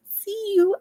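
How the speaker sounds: squeaky high see you; high playful greeting. Real, not acted. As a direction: chirp it up high.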